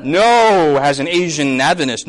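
normal talking voice

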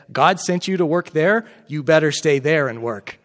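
loud, firm scolding command; firm order from the friend to stay and work; emphatic. Retold or imitated. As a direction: lay down the law like a stern friend. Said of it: "And he's very emphatic".

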